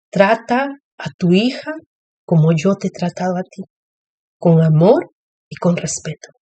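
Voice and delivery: gentle voice of God. Retold or imitated. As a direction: tell her tenderly, almost hushed. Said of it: "entonces el Padre me dijo".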